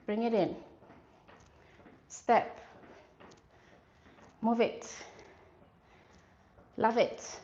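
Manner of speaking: punchy coach calls over music; halftime pep speech. Real, not acted. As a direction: bark out each move to the class. Instructor calling workout cues.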